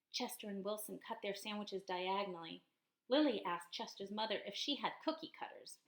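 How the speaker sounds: usual speaking voice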